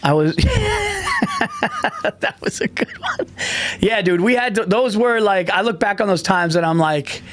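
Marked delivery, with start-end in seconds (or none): 0.4-3.3 wheezing laugh into words. Real, not acted. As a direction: laugh through the line. Laughing hard.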